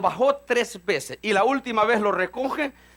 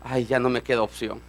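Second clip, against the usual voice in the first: resigned Pedro; 9 semitones lower, 3 dB quieter than usual. Retold or imitated. Voicing Pedro giving in.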